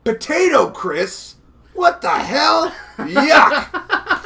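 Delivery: put-on very high, loud kid voice, slow on the first words; Spider-Man kid finding crisps in his candy bag; disgusted kid. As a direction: squeal like a kid who finds potato crisps. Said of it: "pulls out and goes".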